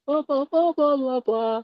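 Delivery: very high, monotone mock chanting; going through motions. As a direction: imitate people droning through a rote ritual. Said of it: "just kind of going through the motions".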